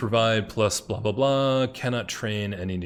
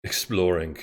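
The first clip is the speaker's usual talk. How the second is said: British accent; 2 semitones lower than usual. Real, not acted. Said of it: "That's such a good accent".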